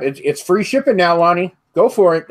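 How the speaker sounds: usual speaking voice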